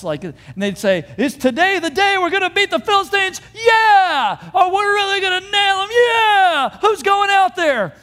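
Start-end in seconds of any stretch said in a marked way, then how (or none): 1.2-7.9 high, loud rallying yell; pep-rally shouts. Acted. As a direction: whip up the troops with hammed-up battle cries. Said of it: "They'd wake up with shouts".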